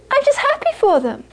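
high, simple, innocent voice; sweetly innocent old monk. Retold or imitated. Quoting innocent Geshe.

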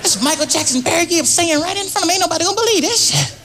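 can't believe your eyes; high amazed exclaim. Retold or imitated. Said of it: "I said".

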